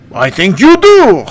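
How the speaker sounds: drawn-out mocking ghost king; Bertu voice. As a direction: ghost king taunting the hero. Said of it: "that's my impression".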